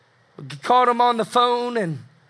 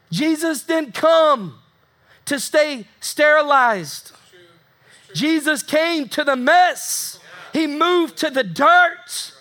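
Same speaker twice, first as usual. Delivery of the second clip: slow, emphatic, each phrase raised high; fervent preaching; 4 semitones higher, slower than usual. Real, not acted. Preacher driving home to the congregation why Jesus came, emphatic.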